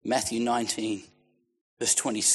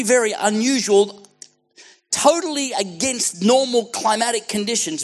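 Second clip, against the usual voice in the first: high, loud, driving declaration; fired-up pulpit shouting; full-force preaching; 12 semitones higher, 10 dB louder than usual. Acted. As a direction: cry it out over the whole hall.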